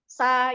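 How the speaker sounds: sa said flat instead of so; mock the wrong sa sound; wrong short vowel. Shown as a demonstration. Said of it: "Jangan disebut yang lain, seperti".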